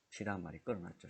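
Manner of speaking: ordinary talk, nothing marked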